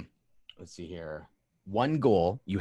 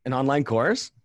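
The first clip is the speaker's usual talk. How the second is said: surprised; 7 semitones higher, 7 dB louder than usual. Real, not acted. Surprised echo of answer.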